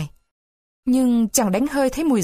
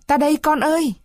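calling back; 7 semitones higher than usual. Acted. Carlo answering Buratino's cry, high call back.

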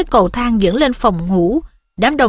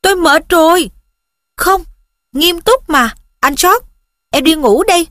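raised, higher-pitched exclamation; louder, high-pitched plea to be left alone; exasperated yell; 6 semitones higher, 4 dB louder than usual. A narrator's reading. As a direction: cry out in tired protest. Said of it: "nó hét lên".

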